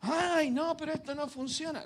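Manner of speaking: put-on high whining kid voice; whiny child. Preacher mimics a child whining at a slow game. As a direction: whine like a frustrated child.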